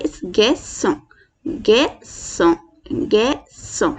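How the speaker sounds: गैसों said slowly, with a clear S sound; French garçon with S. Shown as a demonstration. Teacher repeats garçon slowly for students. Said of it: "ये basically S, kind of pronounce करता है".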